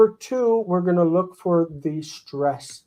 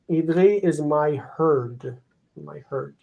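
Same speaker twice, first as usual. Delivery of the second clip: ordinary delivery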